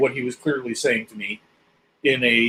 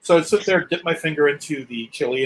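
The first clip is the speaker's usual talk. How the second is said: ordinary talk, nothing marked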